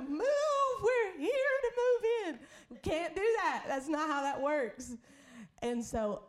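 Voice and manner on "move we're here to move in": high, slow, rising to a loud cry; hammed-up prophetess ordering them out; mock prophetic demand. Pastor mocking showing up to claim the land, high and loud. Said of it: "we can't just show up and be like".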